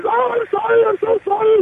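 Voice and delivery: hammed-up weepy wife, squeaky and pleading; mock sobbing wife. Caught wife begging forgiveness from husband, mock sobbing falsetto. Humiliated wife apologizing, then crying.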